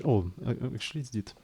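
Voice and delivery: usual speaking voice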